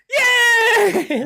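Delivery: very high, loud mock cheer; very high cheering of the fairy tale creatures; crowd cheer. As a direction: scream it like an excited crowd. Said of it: "they're like".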